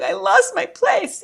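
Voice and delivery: high, loud, drawn-out mock lament; comic weepy panic; mock wail. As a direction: wail it slowly, hamming up the tears. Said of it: "And here I am going".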